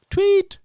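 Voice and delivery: very high, loud mouth whistle; voiced whistle to stop a running dog; whistle imitation. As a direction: blast a sharp whistle sound like a dog whistle.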